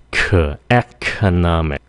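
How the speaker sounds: economic slowly with a puffed kh in the middle; aspirated K. Shown as a demonstration. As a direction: say "Khờ", then carry that breathy K into "economic". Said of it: "phát âm cái khờ".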